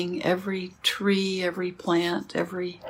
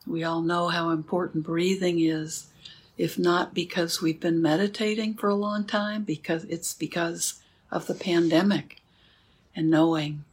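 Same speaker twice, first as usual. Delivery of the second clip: guided meditation; 4 dB quieter than usual. Acted. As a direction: guide the sitting gently, with long pauses. Leads a sitting meditation.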